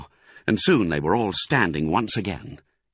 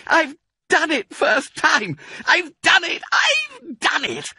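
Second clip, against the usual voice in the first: excited yell; 3 dB louder than usual. A narrator's reading. Mister Fox to his family, high triumphant yell. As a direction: shout it with joy. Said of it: "he yelled".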